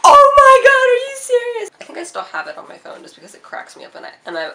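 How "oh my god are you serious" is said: high, loud astonished shriek; mock shocked squeal; shocked classmates. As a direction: imitate stunned disbelief. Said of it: "And they were like".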